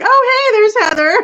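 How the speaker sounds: high, loud "oh hey" of sudden discovery; overdone surprised greeting; mock surprised. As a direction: act like you just spotted her. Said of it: "and I'm like".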